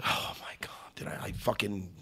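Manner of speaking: awed hushed whisper; host gushing over a fight submission. Pretend. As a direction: breathe it out in mock ecstasy. Rapture over the Kimura.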